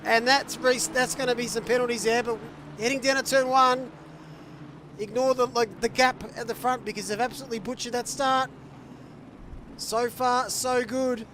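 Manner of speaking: high, loud, exasperated race call; live play-by-play. Real, not acted. Commentator on a butchered start, exasperated high-energy play-by-play.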